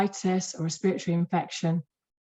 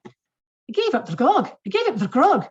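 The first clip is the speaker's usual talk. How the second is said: hammed-up Scottish accent, higher and louder; terrible Scottish accent; 6 semitones higher, 5 dB louder than usual. Recovered patient tells his clinic he quit drinking, in a bad Scottish accent. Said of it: "I've got a terrible Scottish accent".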